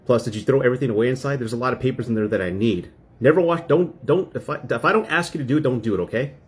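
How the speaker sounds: nagging quote, rising in pitch; retold scolding. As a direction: imitate an exasperated wife laying down rules. Acts out wife scolding him.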